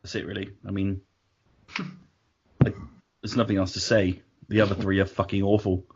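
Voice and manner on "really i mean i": ordinary talk, nothing marked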